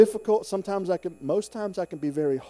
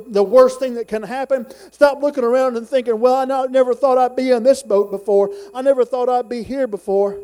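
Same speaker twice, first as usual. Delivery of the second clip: raised, high preacher's delivery; building exhortation, driven louder and louder; full-force preaching; 5 semitones higher, 6 dB louder than usual. Acted. Preacher urging church on to the heavenly goal line, full-force preaching.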